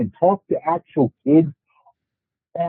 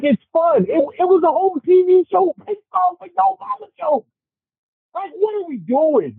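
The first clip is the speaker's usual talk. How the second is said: high-pitched exasperated rant; worked up; 10 semitones higher than usual. Real, not acted. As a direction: pitch up, incredulous and heated. Heated rant about Yo Mama jokes.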